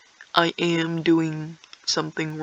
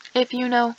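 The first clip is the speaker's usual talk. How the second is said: normal talking voice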